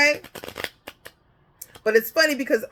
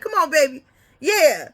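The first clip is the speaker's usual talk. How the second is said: put-on higher and louder, cheering a little boy on; proud father; 6 semitones higher, 5 dB louder than usual. Father urging his little boy to speak up, high and loud. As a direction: hype up your shy son at the counter.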